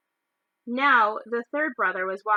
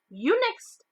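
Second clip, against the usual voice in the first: mother directing; 10 semitones higher, 3 dB louder than usual. Acted. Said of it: "the plump woman said".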